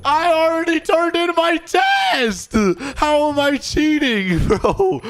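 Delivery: high-pitched incredulous yell; outraged shout. Acted. Student protesting a cheating accusation, outraged shout. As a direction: shout it high, wronged and disbelieving.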